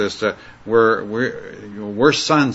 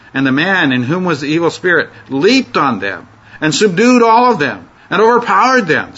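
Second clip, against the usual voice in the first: dramatic scripture reading; 4 semitones higher than usual. Acted. Teacher reads the verse aloud, building to overpowered. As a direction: build the violence as you read.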